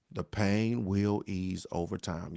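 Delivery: soft, slow and low; slow reassurance. Real, not acted. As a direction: promise it gently.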